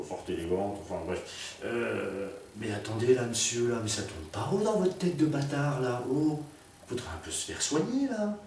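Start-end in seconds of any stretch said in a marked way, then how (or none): 2.6-8.4 higher, hammed-up angry lady; furious lady insulting the narrator; mock angry rant. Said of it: "elle me crie dessus".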